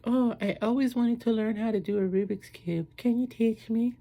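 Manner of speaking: very high put-on female voice; falsetto, keen request; woman he's dating. Woman asking her date to teach her, high eager mock voice. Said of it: "She's like".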